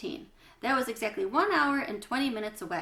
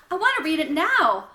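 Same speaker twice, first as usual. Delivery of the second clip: put-on insistent child; 6 semitones higher, 9 dB louder than usual. A narrator's reading. Bat insists, loud and excited.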